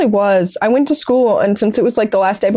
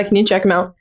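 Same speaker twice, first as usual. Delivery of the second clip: ordinary talk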